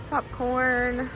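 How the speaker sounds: usual speaking voice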